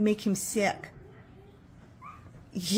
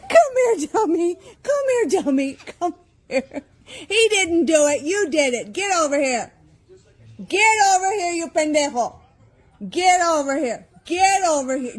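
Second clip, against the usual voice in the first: high-pitched playful scolding of the dog; mock scolding; 8 semitones higher than usual. Real, not acted. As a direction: scold the pet high and teasing, not angry. Scolding the pet in play.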